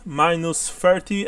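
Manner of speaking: normal talking voice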